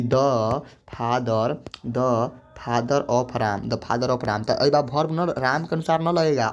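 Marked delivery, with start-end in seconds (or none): 0.0-3.7 very slow, each word apart; drawn-out classroom example; slow dictation. Shown as a demonstration. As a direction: dictate it slowly for students to write. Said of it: "जैसे कहेंगे कि".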